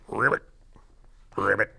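rolled, spaced-out ribbits; short trilled frog call; frog croak. Acted. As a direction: imitate a frog calling at night. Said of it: "it's going".

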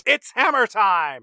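loud, slow catchphrase delivery; one-liner shout. Acted. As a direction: belt it out as a hammed-up action line. Offers a better action-hero one-liner.

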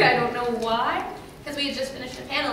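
usual speaking voice

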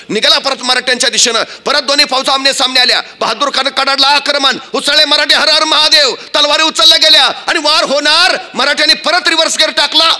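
high, driving storyteller's delivery; rousing battle narration. A narrator's reading. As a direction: narrate it like a battle ballad at full tilt. (जोशपूर्ण युद्धवर्णन)

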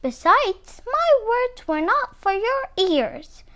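high, indignant storybook shriek. A narrator's reading. Muskrat tells off eavesdropping Armadillo, shrieking. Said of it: "he shrieked".

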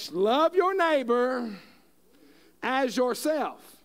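solemn slow proclamation of the commandment; slow emphatic. Real, not acted. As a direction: lay each word down slowly like scripture. Restating the commandment as climax.

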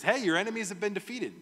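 high, fast and eager; eager messenger. Acted. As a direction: blurt the victory news. Messenger voiced cheerfully.

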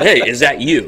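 moderately loud retold call-out; retold yell. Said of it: "And I yelled down".

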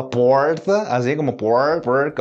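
"porta" and "por" with a retroflex R; São Paulo countryside R. Shown as a demonstration. Teacher illustrates the interior's speech, countryside-R demonstration. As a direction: hold a curled-back English-like R in "por". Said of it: "como em São Paulo, interior ali".

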